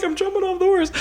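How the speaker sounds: very high, hammed-up panic; mock-frantic squeal of compliance; panicked cry. Scared rider answering the wrangler as she bails, high mock panic.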